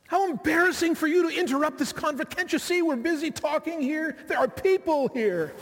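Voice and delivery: put-on an embarrassed kid shooing his parents off; sarcastic child annoyed at interrupting parents; indignant child. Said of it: "Children can be pretty indignant at their parents".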